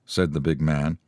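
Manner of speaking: ordinary delivery, nothing marked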